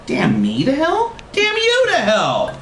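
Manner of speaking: slow, high theatrical retort; incredulous rise, then very high curse back; hammed-up slow comeback, climbing high; indignant countering. A narrator's reading. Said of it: "the Kentucky Fried Contrarian countered".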